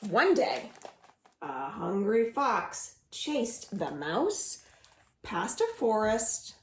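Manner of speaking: usual speaking voice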